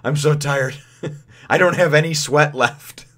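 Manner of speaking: imitating Luffy too tired to go on; whiny tired Luffy. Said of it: "Luffy's just like".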